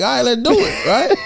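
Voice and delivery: high, laughing easy yes; breezy laughing agreement. Retold or imitated. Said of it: "text and be like".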